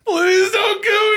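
high hammed-up wail; mock terrified begging. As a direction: imitate a villain faking panic. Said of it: "pretends to have an American accent".

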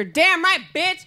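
high, punchy rap chant; rapper over loudspeaker. Retold or imitated. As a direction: imitate an aggressive rap hook. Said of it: "I hear over the loudspeaker".

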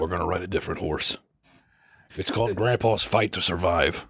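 lightly played low-voiced grandpa. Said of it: "He's like".

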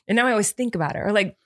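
ordinary delivery

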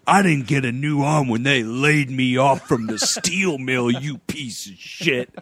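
lightly voiced bitter drunk; bitter laid-off steelworker. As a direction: grumble like a laid-off mill worker. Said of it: "he's, like, bitter about it".

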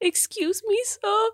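put-on high little-kid voice; small lost child asking; baby voice. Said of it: "do a baby voice".

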